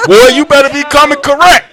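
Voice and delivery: loud, high tough warning; mock threat from the back seat; hammed-up tough girl; imitating the daughter. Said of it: "she was like this".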